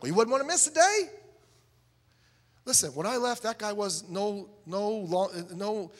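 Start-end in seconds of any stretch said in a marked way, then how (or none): 0.0-1.1 high, loud mock-eager question; naive salesman pitch. Ignorant college student parroting his teacher to a guy, naively eager. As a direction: parrot the line high and loud, rising at the end.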